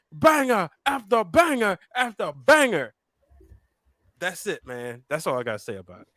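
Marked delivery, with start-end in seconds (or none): 0.1-2.9 high, chanted slogan with punched beats; hyped catchphrase. Acted. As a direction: belt it out like a catchphrase. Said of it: "as they say".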